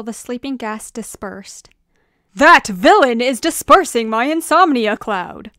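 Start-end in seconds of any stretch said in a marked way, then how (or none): 2.3-5.5 loud, urgent shout; forceful raised-volume outcry; yelling. Acted. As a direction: yell it over explosions. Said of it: "yelled Max Dream amidst the explosions".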